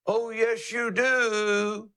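lightly voiced rooster crow; a hint of a crowing rooster; playful rooster call. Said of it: "listen to him".

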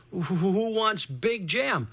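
lightly acted boy bluffing, stumbling on the first word; stammered bravado. Scared boy denying he wants Big Jim, stammering bravado.

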